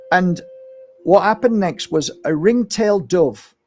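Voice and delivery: ordinary delivery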